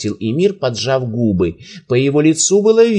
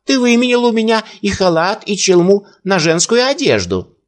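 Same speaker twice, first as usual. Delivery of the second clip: high, raised insistence; insisting old man; 9 semitones higher than usual. A narrator's reading. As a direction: push the claim, voice high.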